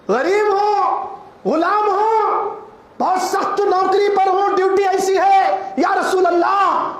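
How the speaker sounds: fervent pulpit cry; preaching at full force. Acted. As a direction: call it out to the whole congregation. Preacher rises to climax.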